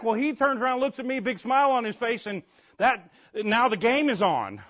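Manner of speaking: usual speaking voice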